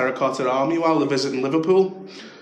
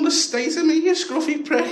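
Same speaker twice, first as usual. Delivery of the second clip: high-pitched mock outrage; comic incredulous comeback; indignant retort. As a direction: throw the insult back, much higher. Comic retort in retold fight.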